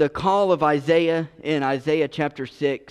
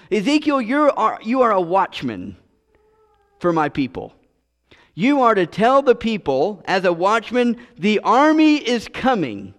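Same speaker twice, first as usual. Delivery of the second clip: higher and louder, urgent warning; raised, pressing call to duty; urgent divine charge; 6 semitones higher, 3 dB louder than usual. Acted. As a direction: sound the alarm like a watchman. God charging Ezekiel as watchman.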